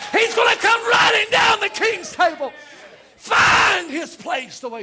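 high, loud, shouted preacher's climax; exultant revival shout, easing at the end; full-force preaching. Real, not acted. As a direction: shout it out in triumph to the congregation.

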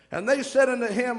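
normal talking voice

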